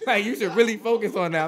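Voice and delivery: laughing through the words; giggling while teasing. Real, not acted.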